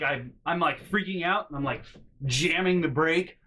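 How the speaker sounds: normal talking voice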